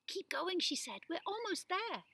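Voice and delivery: put-on girl urging friends onward; encouraging. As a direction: spur them on toward the light.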